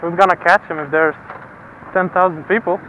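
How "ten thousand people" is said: ordinary talk, nothing marked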